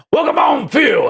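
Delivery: hyped greeting. Real, not acted. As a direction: greet him like a hype announcer. Streamer greets a chat member.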